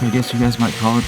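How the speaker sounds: normal talking voice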